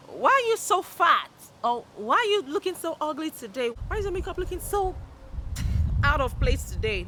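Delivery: high, loud mock-rude questions; silly, blunt honesty. Showing silly honesty to people, mock tactless blurting. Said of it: "I'm not talking about silly honest".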